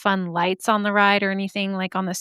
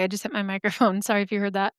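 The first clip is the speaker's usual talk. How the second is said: normal talking voice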